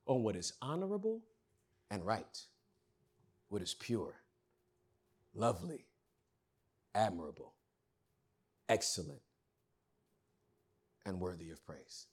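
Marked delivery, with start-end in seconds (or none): 1.9-12.1 much softer, lower and hushed; preacher closing the list, soft; quiet close. Acted. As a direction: let the phrase sink into near silence.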